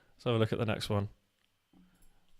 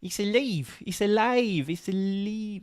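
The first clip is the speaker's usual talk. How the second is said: higher, cartoon Mexican accent; mock Mexican accent; 8 semitones higher, slower than usual. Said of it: "the quote is".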